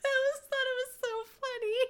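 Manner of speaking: giggling through the words; laughing hard while talking. Real, not acted. Laughing at pony.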